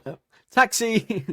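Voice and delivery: high, slow, dopey call of taxi; drawn-out bystander hailing a cab; mock taxi call. Bystander mockingly hailing her as a cab, high and slow. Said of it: "someone going".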